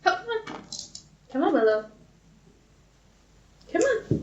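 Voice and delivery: high, sing-song coaxing; encouraging her up in a high coo. Real, not acted. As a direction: coax her up like a pet. Coaxing partner to come up.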